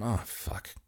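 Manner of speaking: muttered under the breath; quiet dismayed curse. Acted. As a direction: drop to a dismayed hush. Grim Reaper arrives.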